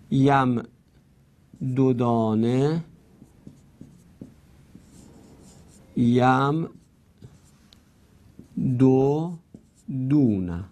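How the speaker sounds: یم دو دانه، یم دو دونه slowly with long gaps; original unmerged form. Shown as a demonstration. Teacher dictating the original form, slow and piece by piece. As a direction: break off after یم, then sound out دو دانه and دو دونه. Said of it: "اصلش اینطوری بوده".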